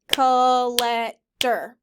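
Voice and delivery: collector said slowly, beat by beat; clapped syllables. Shown as a demonstration. Teacher models clapping for class, slow syllables. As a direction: clap out col-lec-tor, slow. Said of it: "Clap it".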